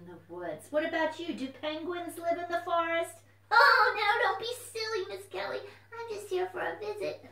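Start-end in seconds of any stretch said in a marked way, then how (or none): 3.5-7.3 high, loud, excited puppet voice; a bouncy little puppet popping up; puppet fox voice. Acted. Puppet fox greets the children, high and loud.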